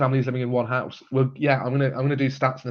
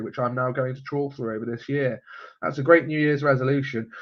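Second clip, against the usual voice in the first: usual speaking voice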